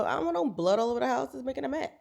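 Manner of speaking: ordinary delivery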